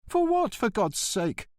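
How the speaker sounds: high, aggrieved protest; the postmaster protests his innocence; indignant postmaster. Acted. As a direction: plead like an injured official.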